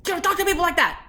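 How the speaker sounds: high, pretend telling-off; mock scolding. Woman imagining smacking the rude man, mock scolding.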